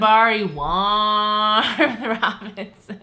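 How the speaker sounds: slow, loud drawn-out name; slow stretched name. Pretend. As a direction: drag the name out with a laugh.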